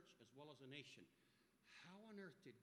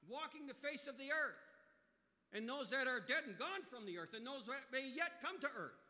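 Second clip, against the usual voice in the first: high, loud, rising proclamation; Jesus proclaiming; 11 semitones higher, 11 dB louder than usual. Acted. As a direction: declare it to the whole earth. Jesus speaking to Morgan.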